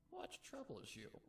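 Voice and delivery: high, gentle grandmother. A narrator's reading. Grandmother soothes crying filly, gentle high voice. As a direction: ask very high and tenderly. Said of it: "The sound of her gentle voice".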